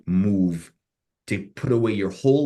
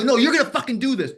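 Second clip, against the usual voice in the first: high, raised-voice demand; retold angry insistence; 4 dB louder, faster than usual. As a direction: overrule him, reenacting a heated row.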